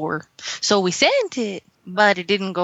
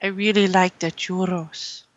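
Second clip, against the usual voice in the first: held mock Mexican accent.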